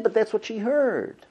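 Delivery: usual speaking voice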